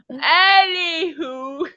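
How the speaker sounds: usual speaking voice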